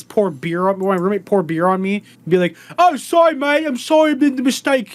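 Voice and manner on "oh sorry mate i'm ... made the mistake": high, loud apologetic British lad; mock British accent. Beer-spilling roommate apologizes to you, mock British accent. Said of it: "rest of the show in that accent".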